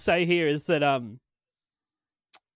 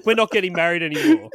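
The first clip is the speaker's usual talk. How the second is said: laughing; 4 dB louder, faster than usual. Real, not acted. Laughing joke.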